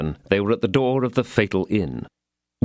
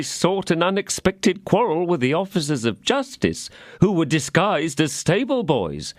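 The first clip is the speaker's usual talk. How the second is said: pleading innkeeper; 8 semitones higher than usual. A narrator's reading. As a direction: beg to be heard. Innkeeper tells the fight.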